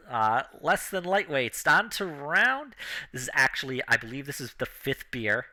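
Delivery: normal talking voice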